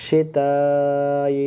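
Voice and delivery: শিতাই with the vowel held four beats; four-alif stretch. Shown as a demonstration. Teacher showing the four-alif stretch, long drawn vowel. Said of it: "টান দিতে হবে চার আলিফ".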